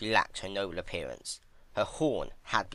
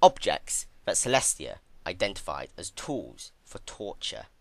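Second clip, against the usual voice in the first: plain reading voice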